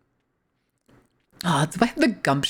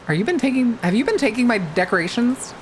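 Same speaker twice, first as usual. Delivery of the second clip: higher-pitched mock interrogation; mock accusation; 5 semitones higher than usual. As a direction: playfully accuse them in a raised pitch. Mock questioning about missing decorations.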